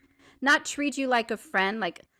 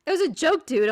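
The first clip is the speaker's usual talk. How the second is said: high, loud protest; acted defensiveness; 8 semitones higher, 8 dB louder than usual. Woman defending her prank to her friend, acted defensiveness. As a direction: push back hard, re-enacting the argument.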